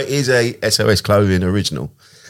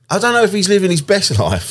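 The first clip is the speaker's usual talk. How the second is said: high mock musing; 11 semitones higher than usual. Imitating own inner thought.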